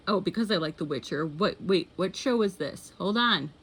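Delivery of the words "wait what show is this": normal talking voice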